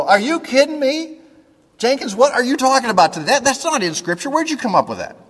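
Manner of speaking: high-pitched, quick pretend protest; mock complaining. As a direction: play an indignant listener objecting to the preacher. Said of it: "That would be complaining".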